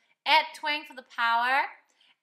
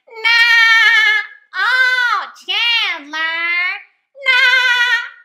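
very loud, high, twangy whine; Janice from Friends; 10 semitones higher, 19 dB louder, much slower than usual. Shown as a demonstration. Teacher imitates a sitcom girlfriend calling Chandler, loud exaggerated twang. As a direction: overdo the twang, drawn out and piercingly loud. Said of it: "We're going to imitate Janice from Friends".

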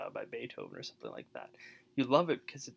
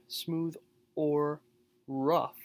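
smooth, or, rough each set apart and louder; separated words; 2 semitones higher, 6 dB louder, much slower than usual. Shown as a demonstration. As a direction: pause hard between smooth, or and rough. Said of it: "you have to make that oh sound".

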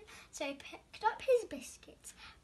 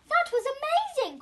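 higher, louder delight; excited cry; 9 semitones higher, 10 dB louder than usual. Acted. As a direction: burst out with joy. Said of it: "cried Luna".

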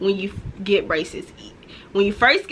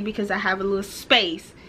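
normal talking voice

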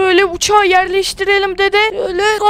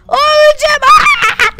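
mock panicked yelp; 7 semitones higher, 4 dB louder than usual. Grandson overwhelmed by zombies.

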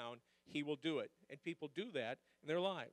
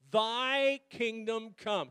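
slow, louder unison reading; leading a recital; 4 semitones higher, 8 dB louder, much slower than usual. Shown as a demonstration. Pastor leading the church in reading the verse, slow and loud. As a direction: pull the congregation along. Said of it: "Read it with me".

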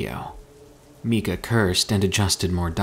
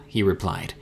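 ordinary delivery

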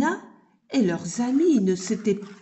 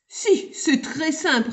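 put-on confident children; 6 semitones higher than usual. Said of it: "répondirent-ils".

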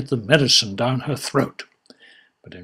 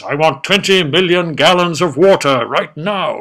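commanding; 4 semitones higher, 7 dB louder than usual. Acted. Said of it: "Admiral Parrot says".

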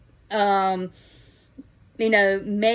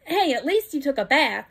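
ordinary delivery

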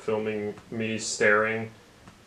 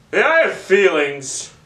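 high whiny voice; 8 semitones higher, 5 dB louder than usual. Acted. Whiny character voice again.

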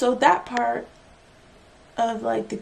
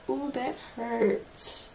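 softer, slower "Oh, that hurts" under the breath; quiet pained wince; 6 dB quieter, much slower than usual. Real, not acted. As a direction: let the sting sink in quietly. Nail pain, said twice.

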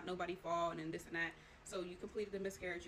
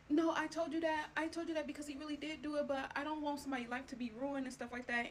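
higher, louder defensive mom voice; imitating mom defending herself; 7 semitones higher, 4 dB louder than usual. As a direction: play the mother insisting, pitched up. Mom's defensive reply retold.

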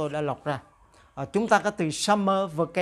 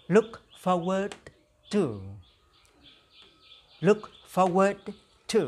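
clear, careful teacher model; model for repeating; 2 semitones higher than usual. Shown as a demonstration. Teacher modelling a phrase for students to repeat. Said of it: "Now repeat after me".

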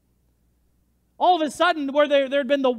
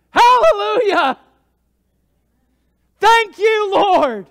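high, full-force shout of praise; jubilant shouting; 10 semitones higher, 13 dB louder, slower than usual. Acted. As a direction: shout thanks like a man just healed. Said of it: "he's just shouting".

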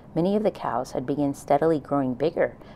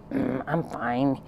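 grumbled; much slower than usual. A narrator's reading. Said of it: "he grumbled".

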